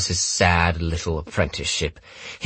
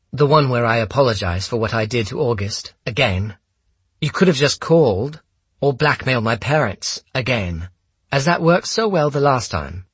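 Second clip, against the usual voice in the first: higher-pitched, cutting sarcasm; sardonic young woman; 7 semitones higher than usual. A narrator's reading. Holmes to the gunman about past quarrels, sardonic. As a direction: toss out each jibe in a raised pitch.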